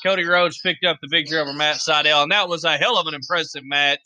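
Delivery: ordinary delivery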